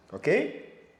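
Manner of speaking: ordinary talk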